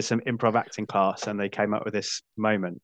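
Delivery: normal talking voice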